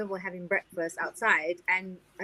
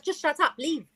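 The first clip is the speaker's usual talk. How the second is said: retold telling-off; 6 semitones higher than usual. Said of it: "I was just like".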